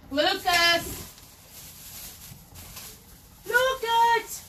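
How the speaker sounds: high, far-off call across the house. Real, not acted. Mom calling her son Lucas from another room, high call.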